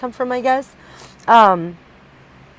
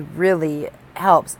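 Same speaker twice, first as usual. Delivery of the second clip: usual speaking voice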